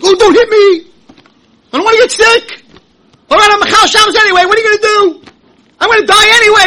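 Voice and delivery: high, loud whiny mock voice; mock the scared generation, high and loud; frightened sinner mocked. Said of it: "That's how they talk".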